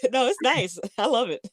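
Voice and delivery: talking through giggles; amused, giggly reassurance; laughing. Real, not acted. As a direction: keep laughing as you say it. Laughing at the sky joke.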